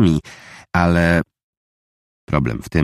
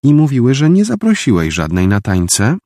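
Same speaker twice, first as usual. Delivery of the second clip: less sweet, sharper; 8 semitones higher, 4 dB louder than usual. A narrator's reading. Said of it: "dodała już nie tak słodko".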